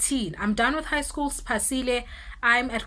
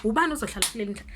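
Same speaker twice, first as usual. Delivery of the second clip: normal talking voice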